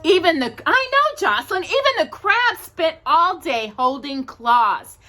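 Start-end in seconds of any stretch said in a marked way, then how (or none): none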